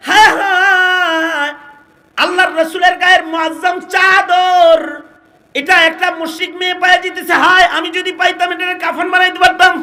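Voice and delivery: lamenting shout that quickens after the opening cry; crying out in regret. Acted. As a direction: act out the companions' anguished outcry at full voice. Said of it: "সাহাবীরা চিল্লায়".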